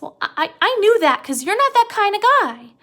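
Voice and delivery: high, loud burst of reassurance; Lenny, excited. Retold or imitated. Lenny answering George, high and eager. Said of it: "Lenny says".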